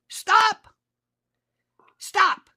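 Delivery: high-pitched, emphatic command to stop; Jesus pleading at a high pitch; exasperated Jesus. Acted. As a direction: cry it out in separate bursts. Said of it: "Jesus would say".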